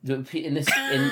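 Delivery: normal talking voice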